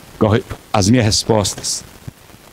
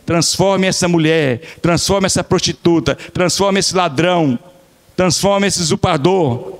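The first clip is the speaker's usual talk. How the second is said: pleading prayer; 5 semitones higher, slower than usual. Retold or imitated. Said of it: "Alguém um dia falou assim".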